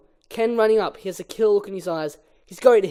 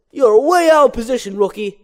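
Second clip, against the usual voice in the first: higher, louder jeering call; mocking taunt; 5 semitones higher, 10 dB louder than usual. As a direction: needle her loudly. [name] taunts Vanessa.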